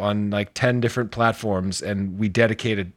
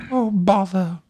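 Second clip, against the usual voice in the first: gentle high Pooh bear; Winnie the Pooh voice; 11 semitones higher than usual. Pretend. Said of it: "Winnie Rourke".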